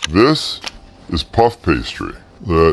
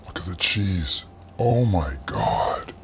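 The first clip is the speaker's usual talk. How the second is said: hushed awe; 10 dB quieter than usual. Real, not acted. Cook marvels at the cheese inside, hushed awe. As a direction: drop to a near whisper in awe. Awed reveal of the melted cheese.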